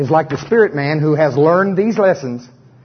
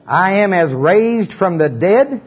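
call-and-response confession; 5 semitones higher, slower than usual. Acted. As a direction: proclaim each phrase boldly, line by line. Leads congregation in confession.

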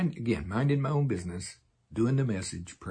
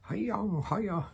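sharp high syllables, imitating tongues that sound Chinese; 11 semitones higher than usual. Preacher showing the congregation how his tongues can sound, high clipped cries. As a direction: jump way up for two quick clipped cries. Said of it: "sounds like Chinese".

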